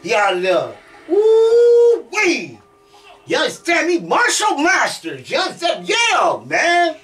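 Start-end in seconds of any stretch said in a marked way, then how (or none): 1.1-2.6 loud, high, long-held whoop; thrilled whoop. Real, not acted. Player celebrating that he's outta there, thrilled whoop. Cheering knockout in the game. 3.3-7.0 high-pitched, emphatic excitement; hyped celebration. Real, not acted. Player celebrating Martial Masters to viewers, hyped crowing. Celebrating win, names the game.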